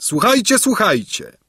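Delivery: high, projected ceremonial announcement; herald's proclamation. A narrator's reading. Herald reading King Lulejka's message, high proclaiming. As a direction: proclaim each title to the whole arena.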